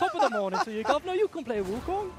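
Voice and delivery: high, loud hammed-up British accent; awful British accent. Caster joking on air in a terrible British accent. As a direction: butcher a stage British accent on purpose. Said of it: "Oh, that was awful".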